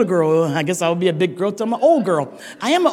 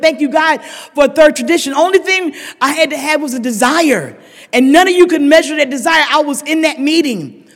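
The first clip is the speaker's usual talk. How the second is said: loud, raised, rousing sermon voice; preaching testimony; 5 semitones higher, 5 dB louder than usual. Real, not acted. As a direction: preach the gratitude out to the hall. Preaching gratitude loudly.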